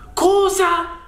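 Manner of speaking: very high, drawn-out exclamation of shock; acted shriek of surprise, pitch shot up. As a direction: react in high-pitched disbelief. Shocked reply to stabbing revelation.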